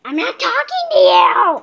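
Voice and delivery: loud high protest; yelling. Real, not acted. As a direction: shout it back at her. Kid yelling at mom.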